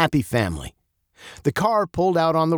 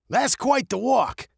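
impressed coach; 13 semitones higher than usual. Acted. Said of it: "Coach whistled".

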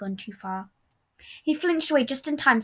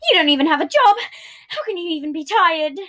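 loud, higher-pitched accusation; raised voice; 6 semitones higher, 6 dB louder than usual. A narrator's reading. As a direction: volume rising into indignant disbelief. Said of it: "his volume rose".

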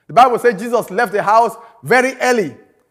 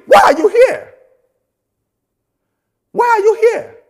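high, pushed question; pressing challenge; 11 semitones higher than usual. Real, not acted. Preacher pressing the congregation, high and insistent. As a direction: press them hard for an answer.

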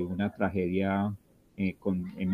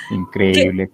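laughing disbelief; 2 semitones lower, 7 dB louder, slower than usual. Real, not acted.

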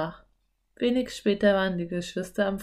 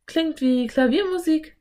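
surprised; 9 semitones higher than usual. A narrator's reading. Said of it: "sagte Anne überrascht".

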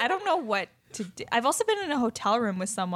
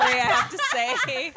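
laughing; 6 semitones higher, 4 dB louder, much slower than usual. Real, not acted. Laughing about the curry.

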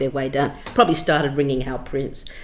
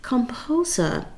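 lightly put-on Chinese accent, higher and hushed; a hint of a Chinese accent, half-whispered bad news; a self-mocking Chinese accent breaking grim news quietly; terrible Chinese accent; 5 semitones higher, 5 dB quieter than usual. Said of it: "I apologise for the terrible Chinese accent".

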